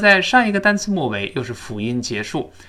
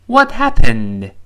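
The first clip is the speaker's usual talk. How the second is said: "What happened" slow and much louder, falling at the end; falling tune; 9 dB louder, much slower than usual. Shown as a demonstration. Teacher models the falling question intonation, slow and loud. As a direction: drop the voice firmly on happened. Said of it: "注意特殊疑问句降调啊".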